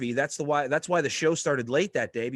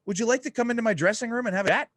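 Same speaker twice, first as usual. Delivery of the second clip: Jerry Lawler's friendly offer; 9 semitones higher, faster than usual. Retold or imitated. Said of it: "he just says".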